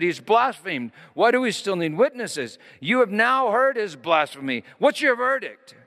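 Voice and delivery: high, loud indignant reading; outraged high priest. Acted. High priest accusing Jesus before the council, high and indignant. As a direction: press the accusation, voice climbing to the final question.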